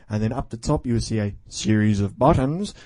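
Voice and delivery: ordinary delivery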